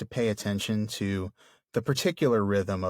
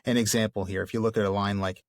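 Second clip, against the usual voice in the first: ordinary talk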